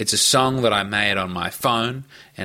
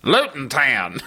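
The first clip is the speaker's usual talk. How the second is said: imitating American pronunciation; slower than usual. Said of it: "the American pronunciation".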